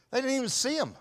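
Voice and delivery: usual speaking voice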